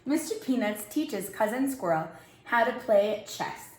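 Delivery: usual speaking voice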